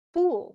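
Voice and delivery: "Fool" stressed high and set apart. Shown as a demonstration. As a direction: hit "Fool" as a strong opening beat. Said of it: "that's probably stressed".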